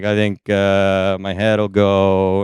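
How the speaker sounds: slow, low, drawn-out hesitation; mock puzzled pondering. Acts puzzling over the massage table.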